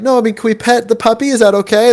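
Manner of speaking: high, careful explaining; lightly voiced polite clarification; patient clarifying. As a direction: spell it out kindly. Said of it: "I was like".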